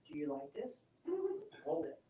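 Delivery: quiet whisper to her body. Shown as a demonstration. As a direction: lean in and ask it softly. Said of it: "I'm gonna whisper to her".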